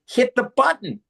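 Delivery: high-pitched mock exasperation; retold order, voice jumping up in frustration; exasperated dad. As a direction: act out a dad losing patience. Acting exasperated dad to small child.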